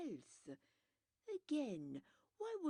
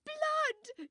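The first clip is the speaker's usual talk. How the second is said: shrieking much higher and louder; hysterical cry; 10 dB louder than usual. A narrator's reading. Widow seeing her red hand, hysterical shriek. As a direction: scream the word in horror. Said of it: "she cried hysterically".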